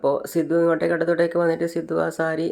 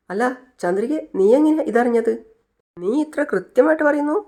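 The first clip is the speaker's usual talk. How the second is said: how on earth do you know that; astonished; 7 semitones higher than usual. Acted. Siddhu asks Chandrika how she knew, rising in astonishment.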